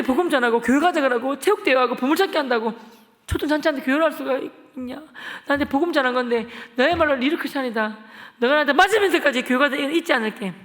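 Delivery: loud, high, emotional thanks to a friend; tearful plea. Retold or imitated. Retelling thanks to the friend who kept inviting him, loud and tearful. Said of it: "제가 펑펑 울면서".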